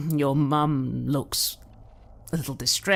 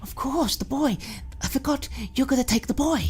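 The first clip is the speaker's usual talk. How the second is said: angry mother; 12 semitones higher, faster than usual. Acted. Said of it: "She gets very angry".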